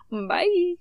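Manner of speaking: high, drawn-out sing-song bye; squeaky stretched farewell; silly high sign-off. Pretend. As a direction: chirp a long goofy goodbye up high. Silly sign-off of mic test.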